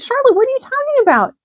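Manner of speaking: high, loud alarmed cry; high loud shocked pig cry; cried out. A narrator's reading. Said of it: "he cried".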